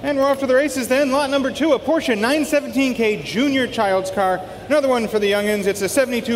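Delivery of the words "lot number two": ordinary talk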